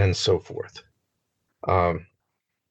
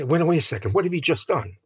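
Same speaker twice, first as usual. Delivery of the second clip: puzzled objection; 7 semitones higher, faster than usual. Retold or imitated. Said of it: "And we say".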